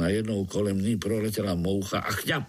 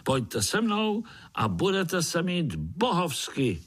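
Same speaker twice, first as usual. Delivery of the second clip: high, drawn-out enticing promise; little spider hero; 9 semitones higher than usual. A narrator's reading. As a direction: pitch it high and stretch it out to win them over. Pája rallies the spiders. (vysoký, protahovaný lákavý hlásek)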